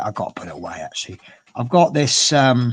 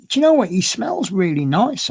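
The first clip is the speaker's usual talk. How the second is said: excited; 8 semitones higher, 6 dB louder than usual. Real, not acted. Excited aside about the cat.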